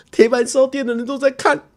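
high, near-crying whisper of panic; a mock cry of embarrassment; panicked inner voice. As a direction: everyone is watching, squeaky and close to tears. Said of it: "我就想说".

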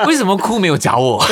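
笑着高声假装抱怨; 半开玩笑地嗔怪; laughing mock complaint. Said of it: "我就说". (笑着假装委屈地问)

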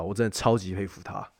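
usual speaking voice

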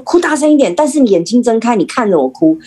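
urging on. Shown as a demonstration. Demonstrating to parents how to coach a crying child, urging. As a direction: egg the child on, starting high.

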